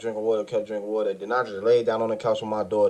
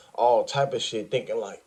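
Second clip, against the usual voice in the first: usual speaking voice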